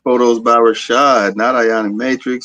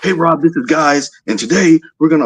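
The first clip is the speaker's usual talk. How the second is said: geeked-up YouTuber intro, higher pitch; Comics Explained; 7 semitones higher than usual. Acted. Fan imitating a YouTuber opening while geeked, excited. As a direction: mimic an overexcited video host's greeting. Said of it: "you know he's geeked when he says".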